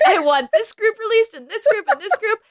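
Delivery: mock villain demanding prisoners released; demanding list. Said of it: "And he's like".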